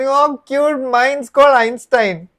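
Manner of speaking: dog owner trumping his dog's name, mock-sweet; cooing lady. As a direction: gush proudly about her own dog. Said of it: "She's turning around and saying".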